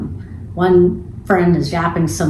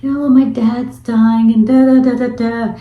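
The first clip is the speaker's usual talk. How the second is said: higher, rambling girlfriend imitation; imitating weird talk; 4 semitones higher, 4 dB louder than usual. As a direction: act out her odd rambling, pitched up. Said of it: "she's just talking weird".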